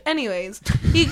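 usual speaking voice

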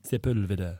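low mock-French SEPULVEDA; imitated French accent. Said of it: "French, and that's how he says".

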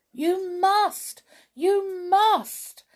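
high, loud insistent shout. A narrator's reading. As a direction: urge desperately, leaning on each word. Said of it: "shouted Binky".

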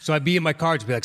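usual speaking voice

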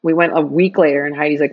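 ordinary delivery

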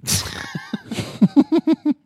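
high bursts of laughter; laughing. Real, not acted. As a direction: crack up in a high giggle. Laughing at the review.

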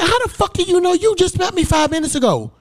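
high, indignant outburst; retold angry retort. Son answering the preacher who claims to know him, high and indignant. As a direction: fire it back, offended.

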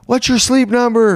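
high-pitched joking call; mock yell. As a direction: yell it out at a passerby, half laughing. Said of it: "did you yell out to him".

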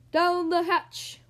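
loud, slow, drawn-out cheer; long, high echoing toast; crew shout. A narrator's reading. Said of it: "we all shouted".

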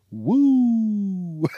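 high, drawn-out "woo"; excited whoop. Real, not acted. Host cheering rising numbers, long high whoop. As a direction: whoop it out in celebration.

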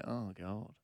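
quiet, low deflated sigh of words; dismayed mutter. Retold or imitated. Teller voices his reaction at the nude beach, quietly dismayed. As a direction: let it drop away softly, appalled.

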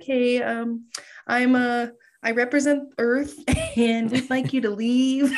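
high, halting politeness; overly polite. Retold or imitated. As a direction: ask it nicely and timidly. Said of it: "real polite".

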